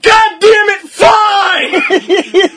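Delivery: high, loud, laughing "fine!"; exasperated giving in. Retold or imitated. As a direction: throw up your hands and yell it. Giving in after tea refusals.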